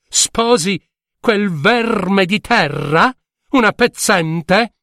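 alta, lenta, piena di disprezzo; regina sprezzante. Acted. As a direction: sputa fuori verme di terra con sdegno. Regina sprezzante rifiuta il matrimonio. (la regina, sdegnata, rifiuta la pezzente: tono sprezzante e lento)

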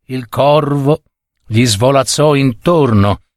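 plain reading voice

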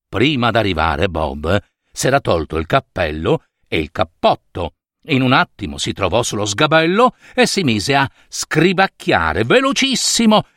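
usual speaking voice

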